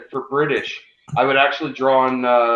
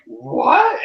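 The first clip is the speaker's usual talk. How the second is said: much higher, a startled yelp of disbelief; shocked what; 4 dB louder than usual. Retold or imitated. Recalling seeing Hugh Laurie in House, surprised what. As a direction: caught completely off guard. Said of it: "I'm just like".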